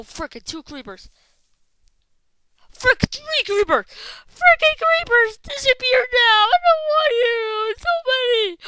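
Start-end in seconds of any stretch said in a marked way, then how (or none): 2.7-8.6 high, loud frantic scream; panicked screaming. Real, not acted. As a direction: scream in panic, half crying. Chased by several creepers.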